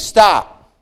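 usual speaking voice

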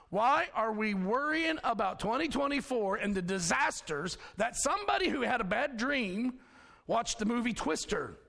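usual speaking voice

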